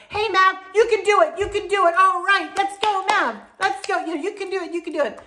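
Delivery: high-pitched family pep cheer; rally the little one like a whole crowd; retold cheering. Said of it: "everybody turned around and looked and went".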